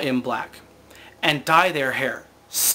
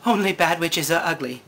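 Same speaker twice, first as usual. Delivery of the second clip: lightly voiced Glinda. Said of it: "And Glinda says".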